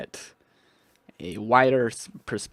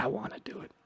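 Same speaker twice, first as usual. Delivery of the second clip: whisper; 9 dB quieter, much faster than usual. Real, not acted. Torn over claiming the village.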